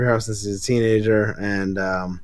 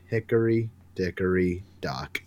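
"Hickory dickory dock" slow, much lower and softer, trailing off; soft closing line; 5 semitones lower, 8 dB quieter than usual. Acted. Harley ending her rhyme over a bloodied Booster, slow and soft. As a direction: let the last "Hickory dickory dock" sink slow and quiet.

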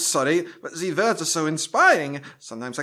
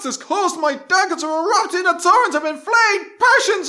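building to a loud high frenzy; carried away; 10 semitones higher, 7 dB louder than usual. Acted. Actor losing himself in the audition, ending in loud high cry. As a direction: let passion run away with you to a cry. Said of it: "going off script".

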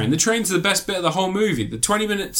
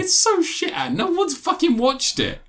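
high, squealed protest; exasperated laughter; 12 semitones higher than usual. Real, not acted. Reviewer defending spoilers to co-host, laughing exasperation. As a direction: protest while cracking up.

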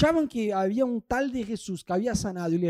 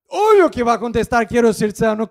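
louder, insistent; emphatic; 3 semitones higher, 13 dB louder than usual. Real, not acted. Preacher stresses the blind man's obvious answer, louder. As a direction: say it like it's obvious.

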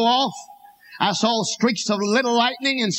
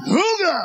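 car horn honk; 9 semitones higher than usual. Retold or imitated.

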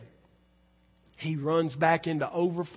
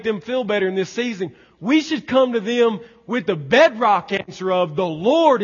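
raised, higher preaching voice; forceful preaching; 5 semitones higher, 8 dB louder than usual. Real, not acted. Preacher answers why we celebrate, preaching forcefully. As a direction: drive the point home from the pulpit.

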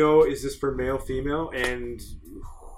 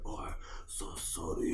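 breathy mock-apologetic whisper, much softer; whispered apology; 9 semitones lower, 12 dB quieter than usual. To girlfriend's mom, a sheepish whispered sorry. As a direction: hush it like a guilty apology. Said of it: "I'm like".

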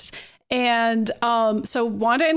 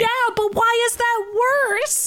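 high, slow, exasperated questioning; incredulous; 10 semitones higher, slower than usual. Real, not acted. Cohost baffled why the new jail is worse, incredulous. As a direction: stretch out the disbelief.

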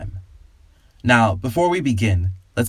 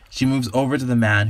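plain reading voice

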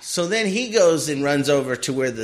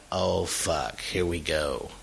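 muttered dread; 10 semitones lower, 9 dB quieter, slower than usual. Retold or imitated. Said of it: "so then I'm like".

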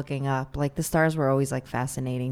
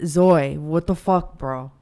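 louder bro voice; casual annoyed bro; brother impression; 6 dB louder, slower than usual. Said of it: "you have your brother".

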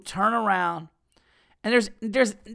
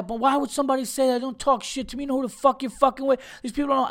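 much higher, fast, hammed-up offended stranger; angry hothead; 7 semitones higher, faster than usual. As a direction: act like the stranger who won't let it go. Angry stranger voiced again.

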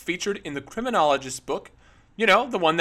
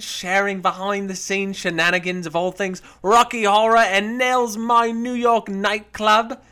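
put-on higher, louder theatrical Australian accent, dripping with luxury; great Australian accent; 6 semitones higher, 6 dB louder than usual. Host reads a star's post in her Australian accent. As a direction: read it as a grand Australian showgirl, all darlings and sequins. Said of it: "That was a great accent".

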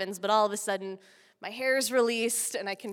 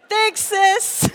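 sarcastic brother; 10 semitones higher, 10 dB louder than usual. Retold or imitated.

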